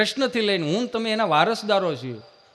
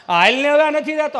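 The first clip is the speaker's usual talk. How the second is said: louder, higher call laughing; impatient call; 7 semitones higher, 6 dB louder than usual. Retold or imitated. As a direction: hurry her up. Calling out, impatient. (પત્નીને ઉતાવળ કરાવતો મોટો અવાજ)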